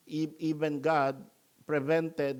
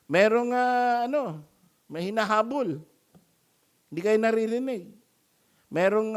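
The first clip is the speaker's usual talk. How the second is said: raised, teasing jeers; mocking taunt; 6 semitones higher, 8 dB louder than usual. Elijah jeering at the prophets of Baal, raised and teasing. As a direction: needle them mock-seriously.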